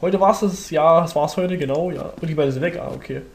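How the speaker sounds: usual speaking voice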